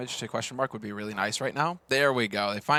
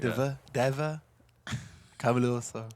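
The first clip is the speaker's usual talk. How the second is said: put-on Danish accent on the names; mock Danish accent, names drawn out. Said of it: "like, a Danish accent".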